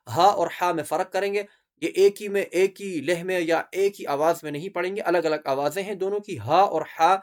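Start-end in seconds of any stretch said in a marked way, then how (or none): none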